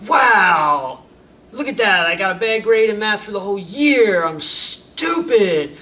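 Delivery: loud, sarcastic self-blame; student yelling at himself over a bad year of grades; bitter outburst. Loud bitter "wow" that slows into "I'm stupid".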